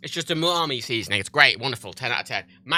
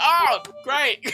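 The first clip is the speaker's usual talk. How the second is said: high, laughing, ironic; sarcastic oh great; 9 semitones higher than usual. Retold or imitated. Reacting to the celebrity train announcer, laughing sarcasm. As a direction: say it with a laughing eye-roll. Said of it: "I'm like".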